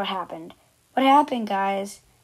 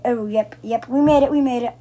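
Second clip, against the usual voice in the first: excited; 6 dB louder than usual. Real, not acted. Narrow escape in obby.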